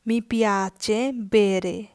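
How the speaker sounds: very slow, every word of Sì, mi piace laid out clearly; slow teaching read. Shown as a demonstration. Teacher reading the yes answer very slowly for learners. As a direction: drawl Sì, mi piace out for the class. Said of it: "নতুন যেই বাক্যগুলো এখানে লেখা আছে".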